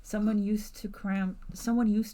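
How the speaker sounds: measured narrator voice; story narration. A narrator's reading. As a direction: set the scene like a storyteller. GM narrates scene.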